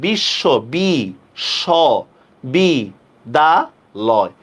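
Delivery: বিশ্ব, then বি, স, বি, দা, লয় each on its own with pauses; বি... স... বি... দা... লয়, spaced out evenly; syllable by syllable. Shown as a demonstration. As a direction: count out বি, স, বি, দা, লয় as separate syllables. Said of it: "যতগুলো vowel উচ্চারণ হবে ততগুলো অক্ষর".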